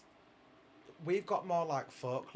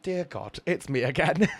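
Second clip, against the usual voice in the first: loud, raised mock prayer to God; 4 semitones higher, 11 dB louder, slower than usual. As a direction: pray at God loudly, in jest.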